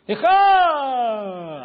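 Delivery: high, loud drawn-out brush-off; dismissive teen. Retold or imitated. Teen son brushing off his father's order, loud and drawn out. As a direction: wave off your dad like a teen.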